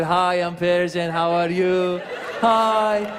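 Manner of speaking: stretched-out, sugary smiling greeting; friendly smiling Persian. Acted. Iranian introducing himself as nicer and friendlier, smiley and drawn out. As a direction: smile through every drawn-out word. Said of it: "we smile when we say".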